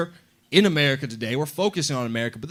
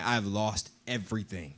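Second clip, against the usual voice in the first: much lower and softer, hopeless; the ruined trader's last sigh; despairing, dropped low; 8 semitones lower, 9 dB quieter than usual. Retold or imitated. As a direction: let the words fall in defeat. Ruined trader leaving in sorrow.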